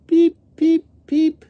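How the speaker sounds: very high, loud, short cheeps; baby chick peeps. A narrator's reading. Little baby chickens, high piercing peeps. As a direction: squeak like tiny chicks. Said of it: "the peeps, are saying".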